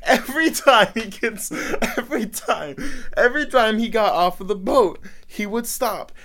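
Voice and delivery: high, loud, laughter spilling into the words; laughing while talking. Real, not acted. Cracked up telling friends about the boat guy, laughing and high. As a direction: barely get the story out through laughter.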